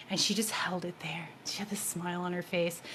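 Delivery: hushed whisper, barely voiced. Real, not acted. To the audience, recalling the healing, a whisper. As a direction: breathe it out like a secret. Quiet moment during healing.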